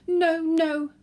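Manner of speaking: high and loud, mock-distressed wailing. Granny insisting the brooch was pinned, wailing. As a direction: wail the denial like a hurt old lady. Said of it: "Granny wailed".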